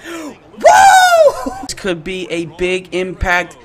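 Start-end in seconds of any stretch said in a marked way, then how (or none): 0.1-1.5 very high, loud "woo"; celebratory whoop. Real, not acted. Gamer celebrating the penalty, high whoop. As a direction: let out a piercing victory whoop.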